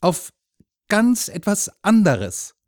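put-on high, loud disdainful boy; scornful, stressed retort; contemptuous. A narrator's reading. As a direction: brush him off loftily. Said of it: "sagte Jürgen verächtlich".

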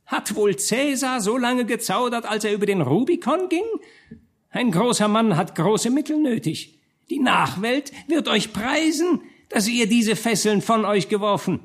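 high, hoarse tempter's voice, coaxing; hoarse, thin voice. A narrator's reading. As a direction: wheedle like the serpent in paradise, high and reedy. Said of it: "sprach er mit heiserer, feiner Stimme".

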